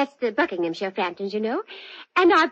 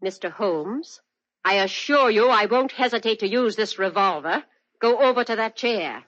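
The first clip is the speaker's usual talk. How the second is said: cold menace; 3 semitones higher than usual. Acted. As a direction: drop the silly act and threaten him coldly. Drops the act, holds him at gunpoint.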